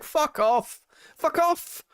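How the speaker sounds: high, exasperated dismissal; exasperated fuck off. Retold or imitated. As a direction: tell them to get lost, high and fed up. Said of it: "I was like".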